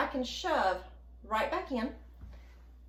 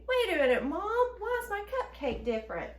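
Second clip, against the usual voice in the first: a hint of a questioning child, pitched up; lightly voiced puzzled kid; 7 semitones higher than usual. Kid asking Mom about the cupcake, mild mock puzzlement. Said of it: "to give your kids a".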